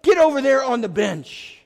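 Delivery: loud, high curt shout; angry order. Retold or imitated. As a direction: send him to the bench with a yell. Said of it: "Coach Boone finally just looks at him and says".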